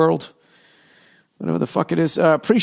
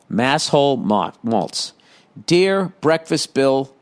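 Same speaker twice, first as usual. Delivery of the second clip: usual speaking voice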